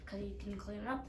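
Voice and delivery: ordinary delivery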